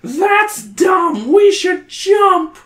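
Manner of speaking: very high, loud, slow retort; arguing. Acted. Patty arguing with Brad to jump. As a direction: shoot down Brad's plan hotly. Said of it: "argues Patty".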